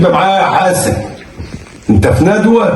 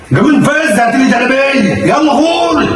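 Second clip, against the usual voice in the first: higher angry dismissal; shouting order; 7 semitones higher than usual. Acted. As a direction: send her off with a yell. Husband shooing wife away.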